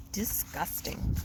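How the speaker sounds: whispered, slow disgust; disgusted aside. Acted. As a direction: breathe it out with distaste. Reader's aside on moldy food.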